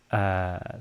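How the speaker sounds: normal talking voice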